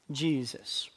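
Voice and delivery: hushed answer. Acted. Call-and-answer, answering own question.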